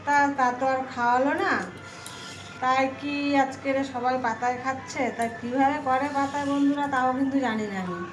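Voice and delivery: ordinary delivery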